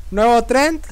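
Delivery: ordinary delivery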